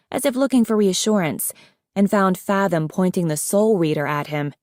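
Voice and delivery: ordinary delivery, nothing marked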